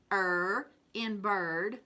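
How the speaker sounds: Er in verse said slowly, er drawn out and rising; slow phonics drill. Shown as a demonstration. Teacher modelling er in verse for children. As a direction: stretch the er so kids hear it. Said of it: "phonics drill for the class".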